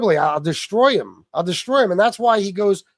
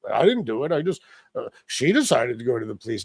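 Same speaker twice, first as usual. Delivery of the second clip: lower, sneering put-on voice of the rival; mocking whiny excuse; 7 semitones lower, 3 dB quieter than usual. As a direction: play him as a weak excuse-maker.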